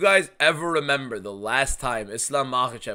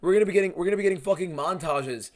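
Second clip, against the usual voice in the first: building into a shout; 7 semitones higher, 6 dB louder, faster than usual. Real, not acted. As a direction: pitch climbs higher, ending in a loud shout. Rising rant about Gary montages.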